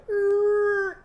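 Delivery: very high, loud "Er" like a scooter wheel; scooter sound. Shown as a demonstration. Teacher imitates Irving's scooter turning a corner, high and loud. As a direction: squeal "Er" round the corner. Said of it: "turning the wheel on his scooter and going".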